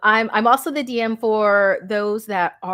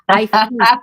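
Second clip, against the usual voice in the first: booming, much louder hammed-up monster; playful villain boom; mock monster; 2 semitones higher, 10 dB louder than usual. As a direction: relish it like a ravenous beast. Hungry for bad accents.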